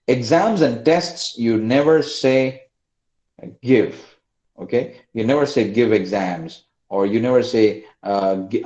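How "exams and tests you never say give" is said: slow, spaced-out words while typing; speaking slowly. Real, not acted. Teacher slowing down while typing notes for class. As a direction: take it slowly, word by word. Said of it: "I'm speaking slowly because I'm typing".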